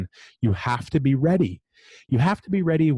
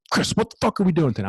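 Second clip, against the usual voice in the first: quick, much higher, eager imitation of the GM; fired up; 7 semitones higher, much faster than usual. General manager at nine in the morning, fired up and quick. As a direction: play the GM pumped up and raring to go. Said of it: "he's, like, fired up".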